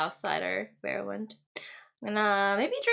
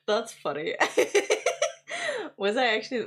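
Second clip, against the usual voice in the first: ordinary talk, nothing marked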